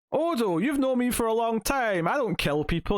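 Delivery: a mock-offended Ferengi barkeep; Quark impression. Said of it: "And Quark's like".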